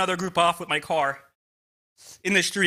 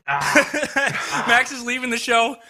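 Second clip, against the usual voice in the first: laughing; 5 semitones higher, much slower than usual. Real, not acted. Laughing while announcing.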